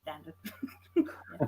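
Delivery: ordinary delivery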